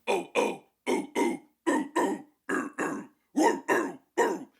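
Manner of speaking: airy barks growing into a voiced gritty oh; bark turning into scream. Shown as a demonstration. Coach shows barks turning into a metal scream. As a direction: build from breathy barks to a rough scream. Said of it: "slowly learning how to turn it".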